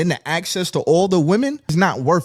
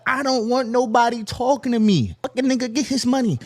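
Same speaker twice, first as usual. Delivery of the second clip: higher, scornful mock voice; mock dismissive woman; 6 semitones higher than usual. Voicing women in the club, high scornful mock. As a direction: brush him off with scorn. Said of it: "really be like".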